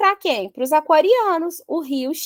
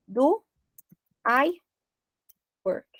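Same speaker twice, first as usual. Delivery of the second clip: slow question; 5 dB quieter, slower than usual. Shown as a demonstration. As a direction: Do I work said slowly with long pauses, rising. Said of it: "eu acordo e me pergunto". (pergunta lenta, palavra por palavra)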